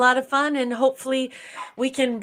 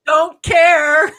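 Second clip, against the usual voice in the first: dismissive; 4 semitones higher, 8 dB louder than usual. Retold or imitated. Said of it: "I saw your trailer".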